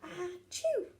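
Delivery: a big high sneeze burst out. Acted. Betty sneezing over her cauldron of food. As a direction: sneeze it out suddenly. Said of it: "which made Betty sneeze".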